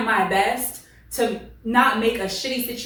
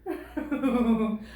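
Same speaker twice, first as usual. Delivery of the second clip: laughter running down after a big fit; trailing laughter. Real, not acted. Reader recovering from own mistake, trailing laughter. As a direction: chuckle it out.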